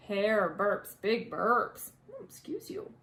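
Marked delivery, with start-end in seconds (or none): 2.1-2.9 mock scolding aside. Aside to burping hare.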